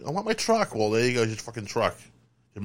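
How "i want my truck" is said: mimicking wife.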